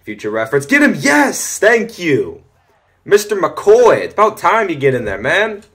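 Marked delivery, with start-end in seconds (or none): 0.6-2.4 much higher, louder cheering; excited shouting. Real, not acted. Teammate finally gets the sack. 3.0-5.6 excited praise. Real, not acted. Praising lineman after sack.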